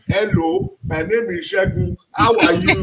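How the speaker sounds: mock Nigerian accent. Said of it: "when you are saying".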